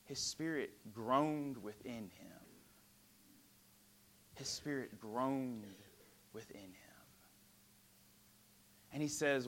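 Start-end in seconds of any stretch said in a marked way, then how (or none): 4.4-7.0 slow, softer then fading to a whisper; hushed. Acted. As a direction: let the words sink to a whisper. Hushed repeat of groaned.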